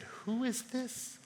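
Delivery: soft, slow, acted wonder; hushed fearful awe. Disciples after the storm obeys, hushed fearful awe. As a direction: ask it slowly, as if awestruck. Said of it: "they were filled with fear and said".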